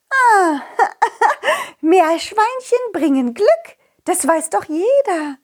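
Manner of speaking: high, laughing princess voice. Acted. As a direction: giggle through it as the princess. Princess character voice.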